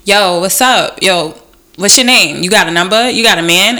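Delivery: loud, pushy catcaller voice; mock a guy yelling after you; street catcaller. Imitating a street guy catcalling her, loud. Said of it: "the guy sees you walking by and he's like".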